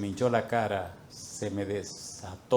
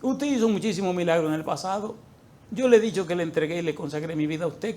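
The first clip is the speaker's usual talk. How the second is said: tearful pleading; 6 semitones higher than usual. Retold or imitated. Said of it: "empecé a llorar de impotencia".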